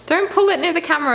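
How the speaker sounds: high, fast frightened plea; begging them to keep the snake away from the lens; panicked plea. Real, not acted. Scared of the snake, begging the handler to keep it away, high and fast.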